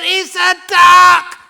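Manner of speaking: very high, slow, deafening shout; hammed-up screaming. Husband at the peak of a silly quarrel, screaming slowly. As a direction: bellow it high, spelling out each letter.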